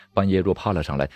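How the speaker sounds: ordinary delivery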